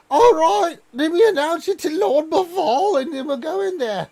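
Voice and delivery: high, loud, squeaky-pitched goblin; high loud goblin butler announcing guests. Acted. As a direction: play an eager little goblin herald, way up high. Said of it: "he's like".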